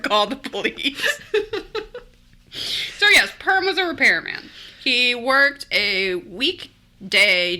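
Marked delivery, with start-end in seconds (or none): none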